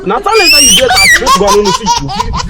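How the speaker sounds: high laughing praise. Acted. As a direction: chuckle through the compliment. Pastor laughing at talent.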